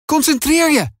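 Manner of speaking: high urgent call to Finn. A narrator's reading. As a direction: call it out sharply, urging. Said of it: "roept Tess". (Tess roept Finn toe, hoog en dringend)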